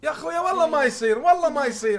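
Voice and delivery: loud, fast, high protest; exasperated shout. Real, not acted. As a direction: yell it in disbelief. Streamer exasperated at game scene.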